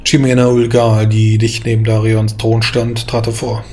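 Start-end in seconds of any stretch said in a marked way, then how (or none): none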